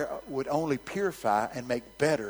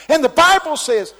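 wound-up preacher voice; young preacher all wound up; 18 dB louder than usual. Retold or imitated. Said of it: "and he s".

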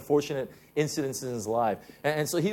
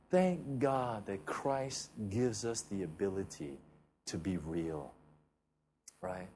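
tender preaching; 6 semitones lower, 7 dB quieter than usual. Acted. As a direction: speak gently of God's love. Tender preaching on God's love.

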